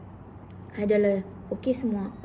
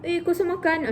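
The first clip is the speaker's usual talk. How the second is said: friendly check-in; 7 semitones higher than usual. Retold or imitated. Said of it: "just cakap macam yang".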